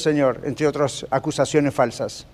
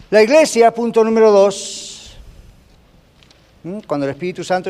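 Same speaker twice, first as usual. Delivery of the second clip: ordinary talk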